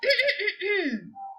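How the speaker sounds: high little cough to get attention; throat clear. Acted. Cricket interrupts sad Gerald, high polite cough. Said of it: "coughed the cricket".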